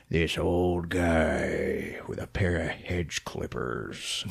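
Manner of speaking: slow, hushed reveal; sinister storyteller's whisper; spooky whisper. A narrator's reading. Spooky reveal in retold film.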